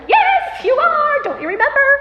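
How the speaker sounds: very high, loud insistent yell; shrieked reminder, easing down in pitch; frantic kids. Retold or imitated. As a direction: imitate panicked kids correcting mom. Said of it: "my kids are getting all frantic".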